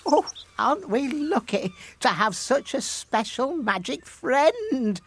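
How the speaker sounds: high, gleeful exclamation; delighted wife. A narrator's reading. Fisherman's wife rejoices in the gold coins, delighted. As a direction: gush with delight, pitched well up. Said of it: "laughed the fisherman's wife".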